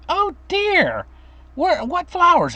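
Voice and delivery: high, lightly voiced confused old lady; sweet old grandma. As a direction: play the dotty, sweet great-grandmother, up high. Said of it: "And she's like".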